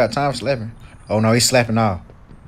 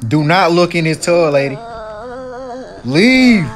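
shouting while hiding; 2 semitones higher, 4 dB louder, slower than usual. Real, not acted. Hiding in tub from ghoul.

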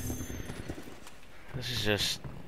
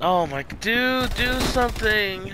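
panicked; 9 semitones higher, slower than usual. Real, not acted. Panicked plea mid firefight.

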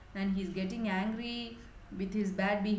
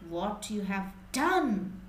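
plain reading voice

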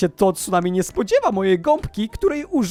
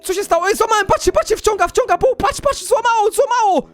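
very high breathless-paced amazement; frantic excitement. Real, not acted. Player breaks the wave with the sponge, high frantic excitement. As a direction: shriek the play as it happens, pitch way up.

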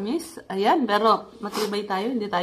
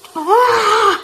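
high, loud scream into laughing; scream into laughter; 13 semitones higher, 7 dB louder than usual. Real, not acted. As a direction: shriek then burst out laughing. Screaming at spicy heat.

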